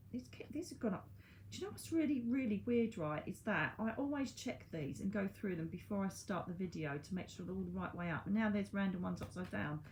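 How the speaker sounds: ordinary talk, nothing marked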